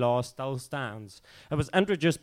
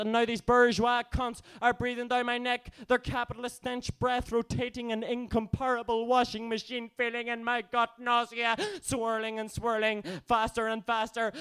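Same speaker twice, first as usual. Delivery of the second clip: raised, intense poetic recitation; spoken-word performance; 10 semitones higher than usual. Acted. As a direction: build the swirling tension in verse.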